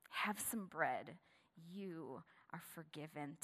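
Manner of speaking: slow, soft and tender; slow soft blessing; gentle. Shown as a demonstration. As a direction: let the forgiveness land softly. Preacher repeats the words gently.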